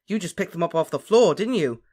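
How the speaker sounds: high, loud accusation; arguing loudly. A narrator's reading. Harry challenges Ron over chocolates, arguing loudly.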